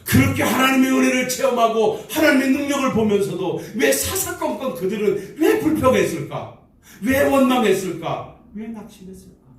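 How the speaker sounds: high, ringing rhetorical questions; fervent preaching. Real, not acted. Pastor asking why Israel complained, in high ringing preaching questions. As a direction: press each why at the congregation.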